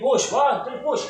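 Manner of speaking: loud, high coaching call; exertion cue. Real, not acted. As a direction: drive the patient on with a loud count. Therapist counts patient up.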